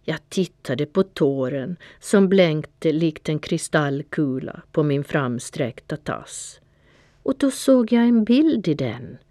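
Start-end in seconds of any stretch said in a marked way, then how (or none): none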